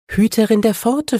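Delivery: high, otherworldly voice full of regret; regretful ghost whisper. Acted. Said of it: "flüsterte der Geist mit einer Stimme, die von Bedauern erfüllt war".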